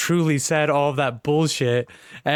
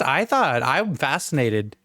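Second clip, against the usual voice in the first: usual speaking voice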